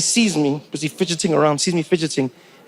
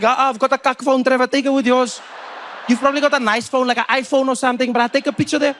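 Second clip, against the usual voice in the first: eager South African voice pitched a bit higher; South African seatmate; 5 semitones higher than usual. Retold or imitated. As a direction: play the keen local guy asking for a selfie.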